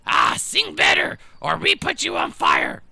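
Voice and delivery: high, loud goblin shout; goblins yelling. Acted. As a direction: screech it at the singer. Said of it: "they just continuously yell".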